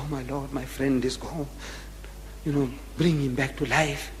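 hushed private plea to God; silent prayer. Acted. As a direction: pray softly, almost to yourself. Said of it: "He's offering up a silent prayer".

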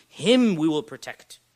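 HIM fronted, loud and drawn out; emphatic stress on HIM. Shown as a demonstration. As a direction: hit "him" hard and slowly, then finish. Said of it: "you are emphasizing".

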